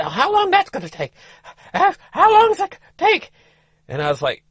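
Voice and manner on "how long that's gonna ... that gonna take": high, urgent wife voice, a bit louder on the repeat; voicing the wife, pitched way up and insistent; Flora's impatient question. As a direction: pester him about how long it'll take. Wife asks how long to exit.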